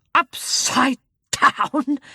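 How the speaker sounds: indignant snort. Acted. Old gentleman snorts at Jane, slow and offended. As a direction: drag the words out, deeply insulted. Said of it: "he snorted".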